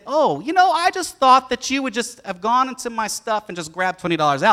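imitating his son making an excuse; sheepish kid excuse. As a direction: talk your way out like a cheeky boy. Son excusing himself to dad.

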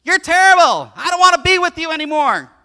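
high, loud squawking insults; loud screechy parrot yelling; parrot screeching insults. Acted. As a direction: shriek abuse like a nasty parrot. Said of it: "starts saying things like".

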